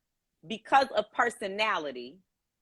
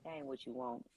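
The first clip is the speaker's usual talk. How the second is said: muttered quote; 10 semitones lower, 13 dB quieter, faster than usual. Retold or imitated. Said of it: "I'm like".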